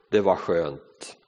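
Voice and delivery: low, slow and soft with relief; relieved sigh. Acted. Teacup cooling on the shelf after the heat, low and relieved. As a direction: breathe out the relief.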